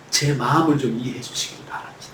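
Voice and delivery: soft whispered prayer; whispered plea. Acted. Preacher as David whispering his first dawn prayer.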